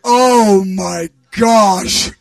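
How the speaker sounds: loud, very drawn-out astonishment; amazed exclamation. Retold or imitated. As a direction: stretch it out loudly, completely amazed. Amazed, wheels turning.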